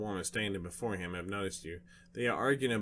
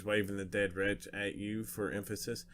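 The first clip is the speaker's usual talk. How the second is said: ordinary delivery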